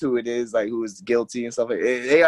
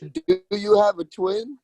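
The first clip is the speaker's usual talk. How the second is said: slow, drawn-out mock question, pitched higher; repeating the host's question back in a teasing, higher, slow voice; much slower than usual. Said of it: "You said".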